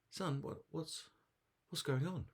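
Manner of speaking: put-on high-pitched snake parent checking on a child; big snake. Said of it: "the big snake says".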